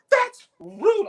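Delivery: high, indignant little protest; offended girlfriend. Retold or imitated. Texas girlfriend scolding the women, high and indignant. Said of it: "So she was like".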